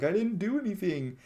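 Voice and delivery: high, pleading mock heartbreak; heartbroken. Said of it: "he's heartbroken watching this man die".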